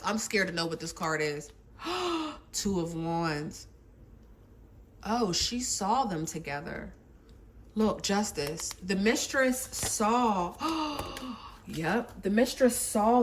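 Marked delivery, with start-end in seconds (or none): 1.8-2.5 sharp, soft intake of breath; shocked gasp. Real, not acted. Tarot reader seeing the card, shocked gasp. 10.6-11.3 long, soft indrawn gasp; shocked gasp. Real, not acted. Tarot reader realizing she saw them, shocked gasp.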